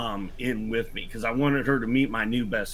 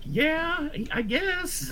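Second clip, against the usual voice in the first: imitating mom; 5 dB quieter, much slower than usual. Mom voiced in retold story.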